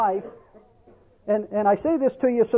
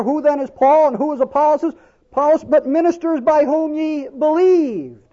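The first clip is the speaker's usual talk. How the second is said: higher, louder preaching at full force; 5 semitones higher, 5 dB louder than usual. Acted. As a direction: preach it out to the congregation with fervour.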